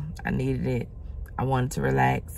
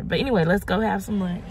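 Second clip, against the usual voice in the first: usual speaking voice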